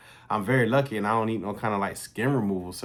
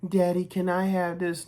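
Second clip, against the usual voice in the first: put-on little girl asking; 9 semitones higher than usual. Little daughter asking daddy in store.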